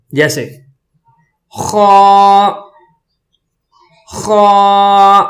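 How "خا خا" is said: loud thick خا with a rasp, held long; scraping throat sound. Shown as a demonstration. Teacher models for students, thick raspy خا. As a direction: scrape the throat, then hold a full, rounded خا. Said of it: "خراش بھی ہو".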